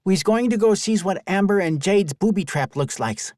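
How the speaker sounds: put-on high, lively young boy; an eager kid off to explore; child voice. Boy announces plan.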